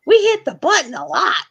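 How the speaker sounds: high, loud mock kid voice; high loud imitation of a young son; child's voice. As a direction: play the proud little boy. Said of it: "My son was like".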